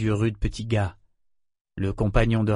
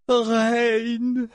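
much higher, long-held cry of the name; voice breaking; 12 semitones higher than usual. A narrator's reading. Mother calls her son's name, voice breaking. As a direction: let the name break with emotion. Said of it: "Sa voix se brisa en prononça son nom".

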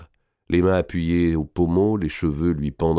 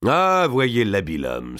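slow, raised-pitch feigned admiration; mocking exclamation; 5 semitones higher than usual. A narrator's reading. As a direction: draw out the sarcastic praise. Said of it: "s'écria Maltraverse". (exclamation goguenarde, traînante)

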